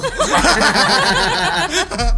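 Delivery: loud high burst of laughter. Real, not acted. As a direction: crack up laughing. Laughing at translation. (tawa melengking)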